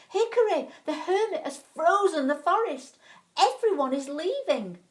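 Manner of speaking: high, loud, breathless alarm; urgent news. Acted. Willow bursting in to warn Hickory, loud and alarmed.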